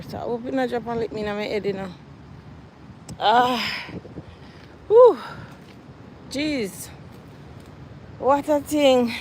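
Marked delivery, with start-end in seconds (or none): 3.2-6.9 out of breath. Real, not acted. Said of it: "I'm tired".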